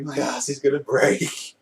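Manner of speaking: hammed-up alarm, played for laughs; mock distress. Voices Gintoki's comic complaint.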